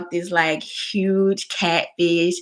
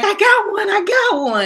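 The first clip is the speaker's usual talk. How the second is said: high-pitched squeal of triumph, held back in volume; mock excited scream; 13 semitones higher than usual. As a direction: reenact screaming over a catch. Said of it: "I'm screaming".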